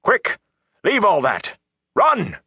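high, strained yelled orders; shouted in punchy, high-pitched bursts; urgent shouting. A narrator's reading. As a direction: bark short commands to flee. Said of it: "Tom shouted".

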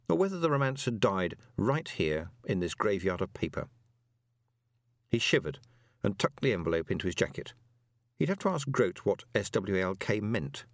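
usual speaking voice

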